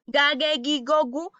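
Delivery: "ga, ge, gi, go, gu" run together, all with hard g; "ga, ge, gi, go, gu" as one string of hard g syllables; mistaken hard G. Shown as a demonstration. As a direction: quote the wrong reading, "ge, gi" like "gue, gui". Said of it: "cuando ustedes le digan que se pronuncia".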